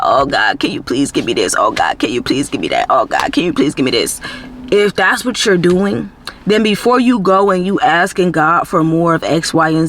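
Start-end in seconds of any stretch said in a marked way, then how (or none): 0.0-4.2 low-pitched mock pleading; begging God. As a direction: plead repeatedly in a much lower voice, imitating a beggar's prayer. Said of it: "you just begging God".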